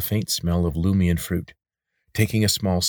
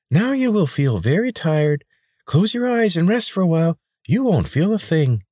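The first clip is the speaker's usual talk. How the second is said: high, oily voice of a chubby healer; pudgy man; 12 semitones higher, 5 dB louder than usual. A narrator's reading. Chubby healer coaxing the injured boy, high and oily.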